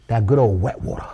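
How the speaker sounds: lightly put-on Cajun accent, brisk; a hint of a Cajun accent, playful and quick. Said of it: "That's my Cajun accent".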